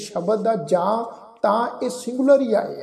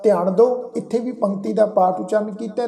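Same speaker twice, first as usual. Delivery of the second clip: usual speaking voice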